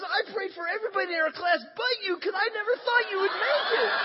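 high, loud imitation of an astonished woman; comic high-pitched confession; mock amazed outburst. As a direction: blurt it out in a high, hammed-up voice. Acting astonished classmate's confession.

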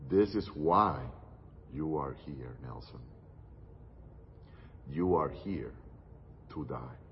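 very soft and low, fading almost to a whisper on "Nelson"; God's answer to the preacher, almost a whisper; hushed. Retold or imitated. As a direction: let God's answer sink to a murmur.

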